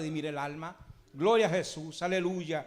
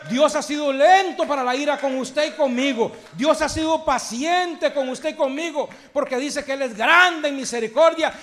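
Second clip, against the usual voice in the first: louder, higher, with shouted full-force preaching; fiery preaching; 5 semitones higher, 6 dB louder than usual. Acted. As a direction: drive it home to the congregation. (predicación a todo pulmón, más alta y fuerte)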